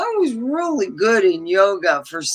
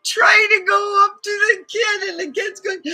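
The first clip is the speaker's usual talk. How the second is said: laughing hard; 13 semitones higher, 4 dB louder than usual. Real, not acted. Laughing while telling clown story.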